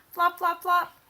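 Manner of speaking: loud, high flop flop flop; flopping sound words. Acted. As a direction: bounce out each flop. Sound words read aloud.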